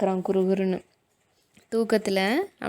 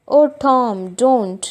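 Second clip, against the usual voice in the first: much louder pleading cry; horrified; 3 semitones higher, 8 dB louder, much slower than usual. Acted. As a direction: cry out in horror. Sid horrified by groans.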